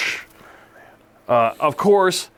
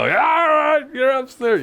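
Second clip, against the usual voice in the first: high-pitched hollered order, imitating an angry parent; mock parental shout; 8 semitones higher, slower than usual. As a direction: play the parent sending a kid upstairs. Acts out parent ordering child upstairs.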